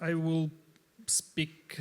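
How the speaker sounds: ordinary talk, nothing marked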